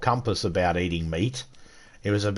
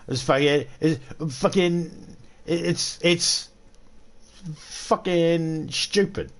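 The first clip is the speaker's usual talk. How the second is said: exasperated drawn-out rant; 5 semitones higher, slower than usual. Real, not acted. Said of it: "Dumb English fucking slag".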